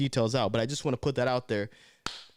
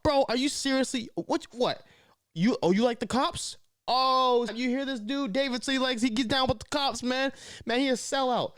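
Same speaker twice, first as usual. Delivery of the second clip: much higher mocking critic voice; 10 semitones higher than usual. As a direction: ham up the disbelieving critic. Mimics critics scolding him.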